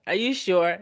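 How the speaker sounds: ordinary delivery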